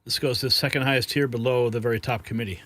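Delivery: usual speaking voice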